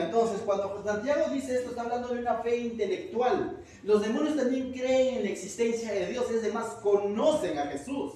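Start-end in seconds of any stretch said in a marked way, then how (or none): none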